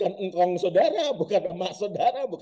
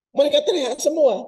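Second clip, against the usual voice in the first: excited; 11 semitones higher, 4 dB louder than usual. Acted. Building to disciples' scream.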